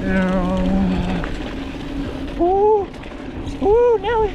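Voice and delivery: high, loud whoops of joy; excited shouting. Real, not acted. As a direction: yell it out flying downhill. Downhill whoops.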